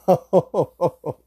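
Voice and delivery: a big real laugh; loud high laugh. Real, not acted. Remembering dad storming in, loud real laugh.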